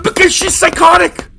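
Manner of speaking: loud, high, exasperated outburst; high-pitched frantic protest; exasperated shout. Acted. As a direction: yell it, fed up and alarmed. Roommate startled by woman in bed.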